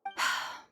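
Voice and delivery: wordless breathy sigh; heavy sigh. Acted. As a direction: let out a troubled sigh. Troubled official pauses before bad news.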